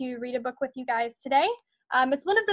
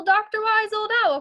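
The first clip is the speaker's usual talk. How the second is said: louder, higher call, falling at the end; calling up again; 7 semitones higher, 4 dB louder than usual. Acted. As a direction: call the sleeping owl once more. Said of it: "called Lizzie".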